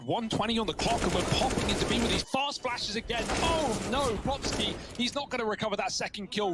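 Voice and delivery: high, building urgency to a shout; excited play-by-play. Real, not acted. Caster calls a live pistol clutch, real excited shouting.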